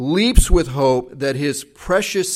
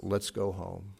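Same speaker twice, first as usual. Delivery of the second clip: tender apology; 9 semitones lower, 10 dB quieter than usual. Retold or imitated. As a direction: say it softly to your hurting son. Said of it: "he says to his boy".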